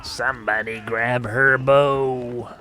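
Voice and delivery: slow, drawn-out echo of a game line; mimicking game character. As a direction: stretch every word in mock imitation.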